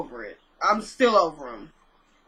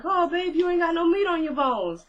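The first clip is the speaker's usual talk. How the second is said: put-on higher-pitched Southern accent, doting; 8 semitones higher than usual. Hugging her thin friend, fussing in a higher Southern accent. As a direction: coo it like a Southern mama in a Southern accent. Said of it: "It was very Southern".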